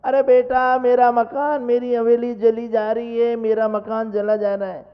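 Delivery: loud, high wailing over the burning house; old father loudly lamenting his burning mansion. Retold or imitated. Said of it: "छाती कूट-कूट के रो रहे हैं".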